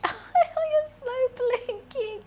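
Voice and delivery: high, slow cooing at the cat; baby talk to pet. Acted. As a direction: coo it to a sleepy pet.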